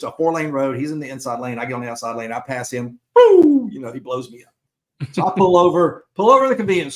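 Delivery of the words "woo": a sharp, high, loud siren whoop; imitated siren whoop. Telling how the cop pulled him over, siren whoop. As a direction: mimic the police siren going off.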